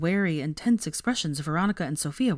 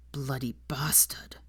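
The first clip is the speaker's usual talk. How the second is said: soft, slow unvoiced mutter; 3 semitones lower, 7 dB quieter, slower than usual. A narrator's reading. Draco cursing Malcolm under his breath outside. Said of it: "Draco muttered mutinously".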